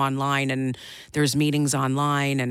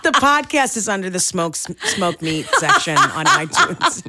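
laughing; 5 semitones higher, 5 dB louder than usual. Real, not acted. Laughing through the joke.

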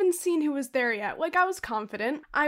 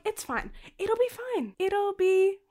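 self-soothing; 6 semitones higher than usual. Retold or imitated. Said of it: "And then I'm like".

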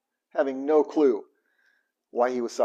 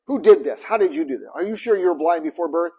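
louder, sharper demanding questions; mock-stern Pharisees, a bit louder; accusing interrogation; 3 semitones higher, 6 dB louder than usual. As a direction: grill him like a suspicious judge. Pharisees interrogating the healed man.

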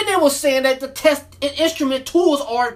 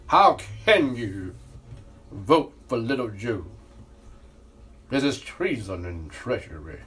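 mock Trump; 13 semitones lower than usual. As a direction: drop the voice way down like a sinister president. Mocking Trump over Joe vote.

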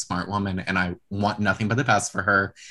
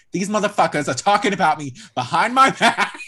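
higher, louder mock complaint; retold outrage; 8 semitones higher, 6 dB louder than usual. Podcaster retells a friend storming out, loud mock outrage. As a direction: act out an indignant outburst, half-laughing by the end. Retelling Dolores angrily leaving party.

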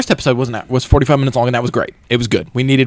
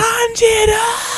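much higher, drawn-out mock scream; hollering Zenitsu; much slower than usual. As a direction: wail his name, stretched long. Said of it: "screaming and hollering for Tanjiro".

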